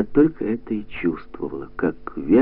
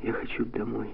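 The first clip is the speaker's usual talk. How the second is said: very soft, small plea; hushed near-whisper, drained of strength; 13 dB quieter than usual. A narrator's reading. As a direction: whisper it weakly. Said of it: "прошептала Катя".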